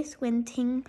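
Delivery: ordinary delivery, nothing marked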